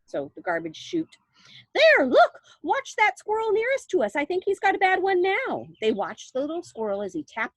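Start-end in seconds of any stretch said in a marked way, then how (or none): 1.7-5.7 high, loud pointing out; excited Wonka. A narrator's reading. Wonka pointing at a squirrel, high and eager. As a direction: urge them to watch, thrilled.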